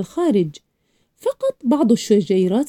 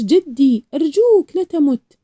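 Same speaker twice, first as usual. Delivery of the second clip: high-pitched pleading, building louder to the end; desperate cry; 6 semitones higher, 4 dB louder than usual. A narrator's reading. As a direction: beg the grandfather not to leave, voice rising. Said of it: "صرخت".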